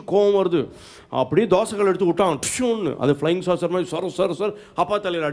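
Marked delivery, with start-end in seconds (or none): 2.4-3.0 high-pitched zooming "dishyoon"; whoosh sound effect. Retold or imitated. Preacher mimicking the flung dosa ladle, high whoosh. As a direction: mimic a flying object whizzing past. Imitating a thrown ladle flying.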